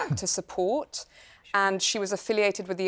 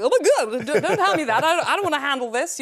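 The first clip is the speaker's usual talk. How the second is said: higher, louder, laughing panic over the precious painting; half-laughing alarm at being handed the replica; alarmed plea; 7 semitones higher, 5 dB louder than usual. Retold or imitated. Actor on set begging crew not to hand her the painting, laughing alarm. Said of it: "it's like".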